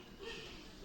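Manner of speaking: a short laugh that falls away; light amused laugh. Acted. Mother-in-law laughs at Charles.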